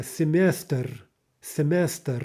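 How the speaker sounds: "semester" as the Russian word, ending on syllabic R; Russian final R. Shown as a demonstration. Teacher demonstrating final R, Russian pronunciation. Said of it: "Again, a final R".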